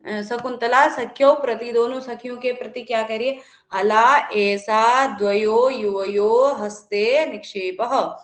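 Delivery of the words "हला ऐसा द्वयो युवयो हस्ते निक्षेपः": drawn-out, emphatic verse reading; teacher reciting Shakuntala's words; slow Sanskrit recitation. Shown as a demonstration. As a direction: intone the line slowly for the class. Recites Shakuntala's line slowly.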